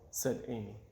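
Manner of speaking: ordinary delivery, nothing marked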